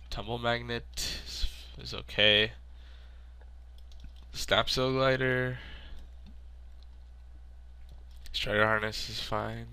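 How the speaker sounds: nasal sick voice; talking through a stuffy nose; blocked nose. Real, not acted. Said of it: "pardon me and my nasally sounding voice".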